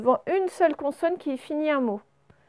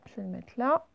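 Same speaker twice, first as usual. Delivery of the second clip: ordinary delivery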